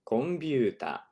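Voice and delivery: Computer said as kompyuta; Japanese loanword. Shown as a demonstration. Teacher models the loanword for students. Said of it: "Comes straight from English".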